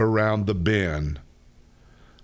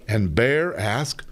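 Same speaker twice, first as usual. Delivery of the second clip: ordinary delivery, nothing marked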